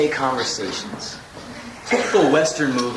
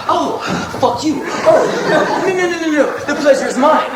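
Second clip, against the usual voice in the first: high, gushing mock courtesy; overly polite; 11 semitones higher, 5 dB louder than usual. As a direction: insist with hammed-up politeness. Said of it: "These overly polite men".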